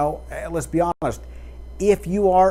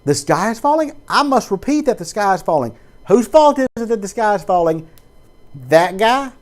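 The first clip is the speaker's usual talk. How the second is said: raised, loud hammed-up panic; mock alarmist; 5 semitones higher, 3 dB louder than usual. Preacher mocking a worked-up church member, pitched up. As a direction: whip up blame like a gossip.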